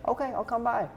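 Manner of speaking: high, goofy imitated agreement; Adam Sandler. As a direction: comedian impression giving in. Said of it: "my terrible Adam Sandler impression".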